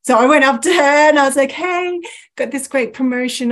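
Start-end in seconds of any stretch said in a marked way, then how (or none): 1.5-3.5 high, bright-hello sales greeting; sales girl pitch. Retold or imitated. Sales girl greeting a customer in the store, chirpy pitch. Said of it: "I'm a sales girl through and through".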